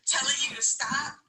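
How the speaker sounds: ordinary talk, nothing marked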